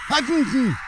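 hoher, lauter Schrei; plötzlich schrill losschreien; lauter Aufschrei. Real, not acted. Ruft erschrocken im Spiel. (lauter, hoher Aufschrei)

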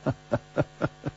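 breathy unvoiced chuckle; a short airy laugh before speaking; soft huffed chuckle. A narrator's reading. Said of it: "楚留香笑着说".

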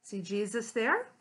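usual speaking voice

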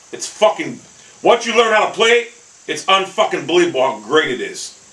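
normal talking voice

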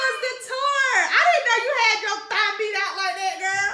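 excited high voice. Real, not acted. Excited high-pitched teasing of a friend.

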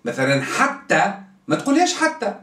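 ordinary talk, nothing marked

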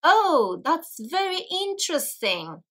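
high, lilting, overly courteous interest; feigned polite interest. Retold or imitated. English person politely hiding dislike, feigned interest. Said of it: "don't like something and they try to be polite".

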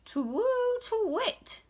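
high, hooting owl call; cross owl. A narrator's reading. Owl muddled up with Douglas, hooting crossly. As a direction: hoot it out in annoyance. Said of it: "said the owl crossly".

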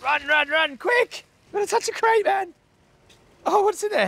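high, urgent calls to hurry; real excited dash; excited rush. Real, not acted. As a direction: hurry the others on, buzzing. Dashing to crates during a raid.

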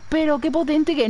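high, prissy mock voice for small-gun fans. Streamer mocking fans of small guns to Terra, high mock voice. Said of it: "se lo dije a Terra en plan".

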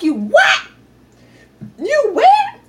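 high, loud disbelieving yell; shocked mom yelling. Retold or imitated. Mom on the phone yelling at her lost daughter, high and loud.

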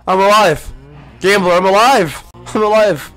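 high, loud, drawn-out relieved cry; hammed-up joy. Gamer overjoyed at surviving the poison.

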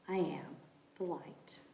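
hushed whisper. Acted. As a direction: breathe the words softly. Quoted reply, whispered.

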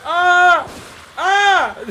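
short, high, loud "ah" yells; playful yells. Pretend. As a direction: let out sudden high shouts. Yelling during frantic game play.

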